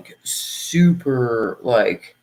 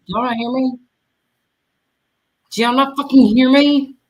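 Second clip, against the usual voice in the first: frustrated shout, higher and louder; calling out, annoyed nobody answers; yelling at chat; 8 semitones higher, 4 dB louder than usual. Real, not acted.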